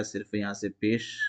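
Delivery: usual speaking voice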